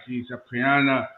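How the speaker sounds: ordinary talk, nothing marked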